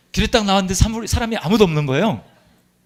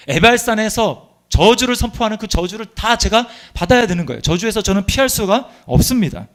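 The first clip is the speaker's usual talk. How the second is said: higher, louder preaching; full-force preaching; 7 semitones higher, 5 dB louder than usual. Acted. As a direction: drive the point home from the pulpit.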